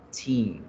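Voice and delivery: put-on "Teen" long and weighted, as a final syllable; stressed TEEN. Shown as a demonstration. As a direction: hold the ee of TEEN. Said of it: "la fuerza se hace en la última sílaba". ("Teen" alargado, como sílaba fuerte)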